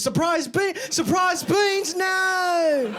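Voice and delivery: high, loud mock shriek at surprise beans. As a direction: squeal in fake horror at the beans. Mock horror at hidden beans.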